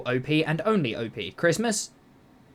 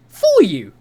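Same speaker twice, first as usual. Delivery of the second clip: voice crack; 3 semitones higher, 11 dB louder than usual. Real, not acted. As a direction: voice cracking on a loud word. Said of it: "Ignore that voice crack".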